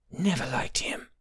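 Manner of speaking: whispered spite; hissed to herself. A narrator's reading. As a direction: hiss it under your breath in a whisper. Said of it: "she hissed to herself".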